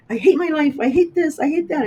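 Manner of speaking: high, loud mock angry complaint; hammed-up teenage "I hate" rant. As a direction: imitate your younger self raging at life. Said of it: "like, angry and".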